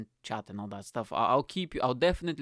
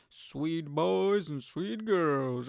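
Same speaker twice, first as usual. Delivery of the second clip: drawn out; 3 semitones higher, much slower than usual. Acted. Drawn-out greeting to listeners.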